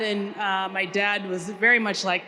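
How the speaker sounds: ordinary talk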